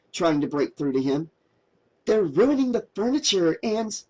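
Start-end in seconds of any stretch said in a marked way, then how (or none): 2.1-4.0 higher voiced anxious girl pleading; young woman, urgent. A narrator's reading. Mary urging drunk Josh to get up, high and anxious. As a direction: lift into Mary's worried valley-girl voice.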